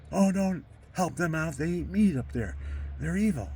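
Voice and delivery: hammed-up priest condemning them; indignant Monsignor. Monsignor's warning repeated, heightened.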